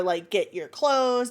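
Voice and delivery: ordinary delivery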